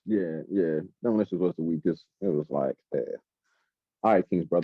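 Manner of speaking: deep Demolition voice. Retold or imitated.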